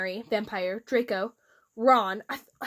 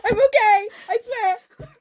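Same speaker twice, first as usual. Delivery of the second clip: laughing; 10 semitones higher, 6 dB louder than usual. Real, not acted.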